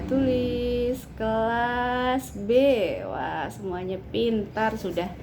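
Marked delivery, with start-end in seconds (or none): 0.1-2.5 slow, level, stretched syllables; drawn-out dictation. Real, not acted. Teacher writing for children, saying each word slowly. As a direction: draw each word out as you write it.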